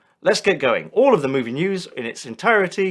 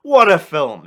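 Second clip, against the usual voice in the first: excited shout; 8 semitones higher, 9 dB louder, slower than usual. Acted. Mock-excited movie review.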